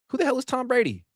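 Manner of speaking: high-pitched mock outburst; mocking Crosby. Mocking Crosby calling out Brady, high indignant outburst. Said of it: "Crosby called him out on it".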